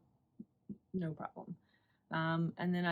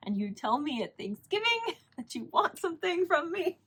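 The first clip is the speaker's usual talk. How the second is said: high-pitched, half-laughing indignation; mock exasperation; 10 semitones higher, 4 dB louder than usual. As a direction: scold in playful disbelief, voice climbing high. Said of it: "strangle her a little bit".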